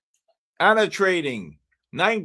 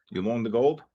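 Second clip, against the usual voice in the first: normal talking voice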